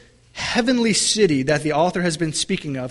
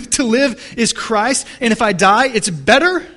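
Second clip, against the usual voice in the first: incredulous; 5 semitones higher, 5 dB louder than usual. Pretend. Preacher to congregation, mock disbelief at Paul's words. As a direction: echo Paul as if it can't be true.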